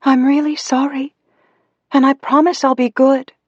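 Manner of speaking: put-on high-pitched, apologetic little girl; pleading childish voice. A narrator's reading. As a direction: promise as a small child begging forgiveness. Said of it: "Her childish voice through the speaker sounded so sad".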